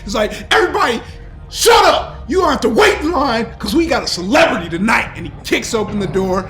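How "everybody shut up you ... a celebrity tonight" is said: high, loud, hurried announcement; excited bouncer shouting. Acted. Bouncer clears the line for a celebrity, shouting excitedly. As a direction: yell over the crowd, thrilled and rushing.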